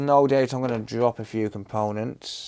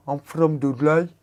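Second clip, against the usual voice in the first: put-on Dudley accent; 3 semitones higher than usual. Streamer imitating how Dudley locals talk, in a Dudley accent. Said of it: "That's how they talk there".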